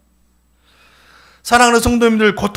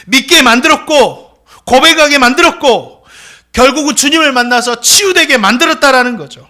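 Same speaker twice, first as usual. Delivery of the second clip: building preaching climax; 3 dB louder than usual. Real, not acted.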